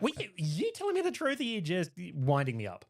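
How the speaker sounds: squeaky incredulous question; high-pitched disbelief. Real, not acted. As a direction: voice jumps up in disbelief. Teasing about secret handshake.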